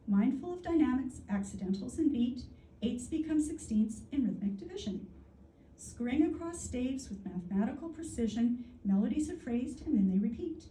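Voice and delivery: lilting verse recital with lifted line openings; lively, rhythmic pantoum delivery; rhythmic poetry reading. Real, not acted. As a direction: read the repeating lines with a musical swing. Reads own pantoum aloud.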